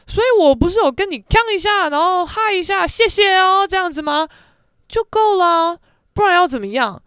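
loud, fed-up self-justification; indignant rant. Retold or imitated. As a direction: argue back at a scolding friend. Indignant argument with friend.

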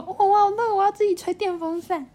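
put-on high, pleading little kid; a small child demanding the fan; whiny child voice. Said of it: "我就说".

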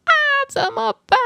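sudden high panicked wail, close to tears; reliving the moment she paid by accident, panicked high cry. Retold or imitated. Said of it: "然后我就是吓到语无伦次".

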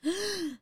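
sharp gasp of delight; surprised gasp. Acted. Hungry guest gasps at offered food, surprised gasp.

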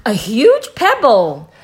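high, loud excited exclaim; bright cry over a discovery; a kid thrilled by a find. Acted. Child character exclaims find.

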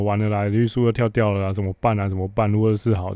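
ordinary talk, nothing marked

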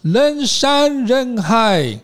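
模仿外国人慢慢讲中文; foreigner's halting Chinese. Retold or imitated. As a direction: imitate a foreigner sounding out Chinese word by word. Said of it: "他讲得不是很顺".

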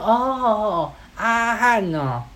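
high, slow drawn-out acknowledgement; wannabe gangster. Retold or imitated. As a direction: imitate a punk stretching the name out high. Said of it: "还想要学人家当加九".

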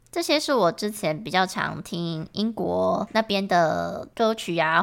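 normal talking voice

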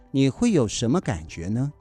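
usual speaking voice

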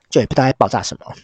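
usual speaking voice

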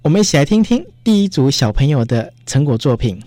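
normal talking voice

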